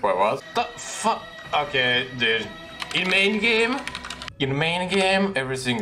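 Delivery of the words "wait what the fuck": ordinary talk, nothing marked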